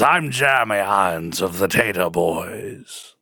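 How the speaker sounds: lightly voiced British gentleman; Jeremy Irons. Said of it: "I could do my Jeremy Irons".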